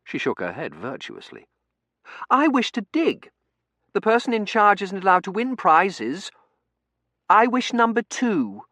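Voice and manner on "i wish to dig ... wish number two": put-on very high, loud child voice; high-pitched girl making demands; imperious little girl. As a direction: insist like a prim, bossy child. Eglantine voiced in story.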